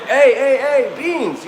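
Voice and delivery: high, loud excited call of his name; excited neighbor calling. Retold or imitated. Neighbor spots Beans pulling up and calls out excitedly.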